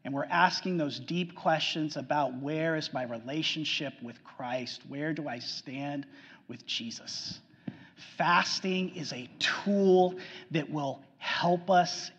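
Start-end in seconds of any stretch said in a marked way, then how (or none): 2.4-7.4 soft inner question, fading to a breath; hushed self-questioning. Acted. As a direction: ask it quietly to your own heart, dropping to a whisper. Asking deep soul-searching questions.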